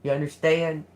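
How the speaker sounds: ordinary delivery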